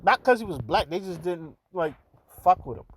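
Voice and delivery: normal talking voice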